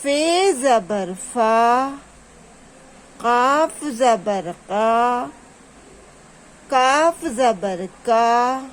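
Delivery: slow, even letter chant with level held endings; drawn-out repeat drill. Shown as a demonstration. Teacher asking pupils to repeat, drawn out. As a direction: lead pupils syllable by syllable, vowels stretched. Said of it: "دوبارہ سے repeat کیجیے".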